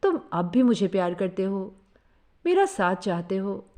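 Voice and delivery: ordinary delivery, nothing marked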